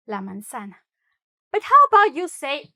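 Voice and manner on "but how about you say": ordinary talk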